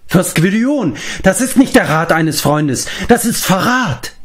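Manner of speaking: high, shocked outcry; appalled accusation. A narrator's reading. Taran to his revered lord, horrified accusation. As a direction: recoil in horror and accuse.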